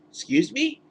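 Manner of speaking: shocked and offended; reacting to the covenant idea, incredulous. Real, not acted. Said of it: "incredulous outburst".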